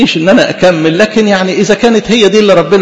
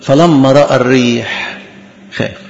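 slow scripture reading; 6 semitones lower, slower than usual. A narrator's reading. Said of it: "يقول لك".

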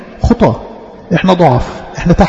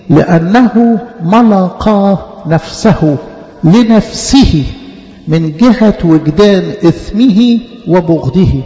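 slow verse reading; much slower than usual. Real, not acted. Preacher reads verse slowly.

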